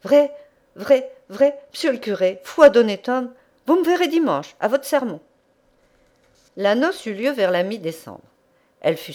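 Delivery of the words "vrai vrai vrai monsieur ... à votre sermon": higher, louder, overjoyed peasant promise; bégaiement ému. A narrator's reading. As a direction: repeat the first word haltingly, then burst out gratefully. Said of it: "la broyait en bégayant". (bégayant d'émotion)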